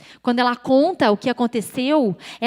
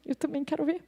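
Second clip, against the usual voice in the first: deadpan inner thought; 4 semitones higher, 6 dB quieter than usual. Retold or imitated. She secretly doubts herself before the voice, soft helpless aside. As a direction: think it aloud quietly, poker-faced. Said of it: "fiz uma cara de paisagem de novo e pensei".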